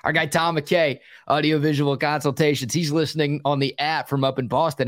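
ordinary talk

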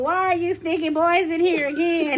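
a laughing imitation of a mom yelling; mock yelling mom. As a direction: yell it like an angry mom catching her kid. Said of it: "She's yelling at her".

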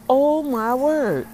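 awed disbelief; amazed. Retold or imitated. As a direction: draw it out in wonder. Amazed at the gift.

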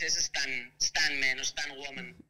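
slow, careful "stan man" and "stan woman"; English pronunciation. Shown as a demonstration. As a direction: model the English way, slowly. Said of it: "si vamos de anglosajones".

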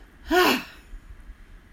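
high, loud sigh released on the drop; loud emphatic sigh. Shown as a demonstration. Teacher demonstrating the shoulder drop with a loud sigh. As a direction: let the shoulders fall with a big loud sigh. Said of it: "a loud, emphatic sigh on the out-breath".

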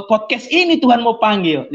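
normal talking voice

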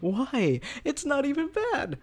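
high, disbelieving protest; incredulous high voice. Real, not acted. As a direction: squeak it out in disbelief. Friend refuses French.

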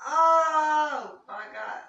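sudden high frightened scream of "Oh, my God"; retold scream. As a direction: shriek it as if startled in the dark. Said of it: "and I screamed".

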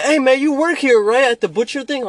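high, loud puzzled customer question; customer's voice. Retold or imitated. Confused customer asking if he works there, high and loud.

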